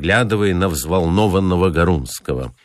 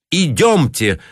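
exclaimed; 8 semitones higher, slower than usual. A narrator's reading. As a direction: bark out the decision. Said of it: "воскликнул Ананий Семёнович".

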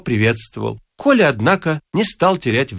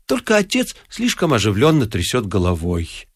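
plain reading voice

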